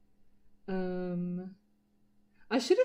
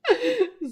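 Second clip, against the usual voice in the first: loud burst of laughter; 13 semitones higher, 11 dB louder than usual. Real, not acted. Laughing at own fake accent.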